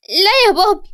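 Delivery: put-on high-pitched little boy pleading; high, whimpering kid's voice; tearful child. A narrator's reading. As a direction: plead with dad through tears. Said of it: "علي بدموع".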